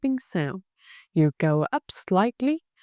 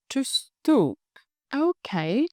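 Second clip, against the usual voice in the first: ordinary delivery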